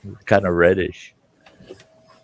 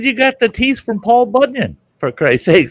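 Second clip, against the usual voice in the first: mock disbelief; 12 semitones higher than usual. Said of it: "I said".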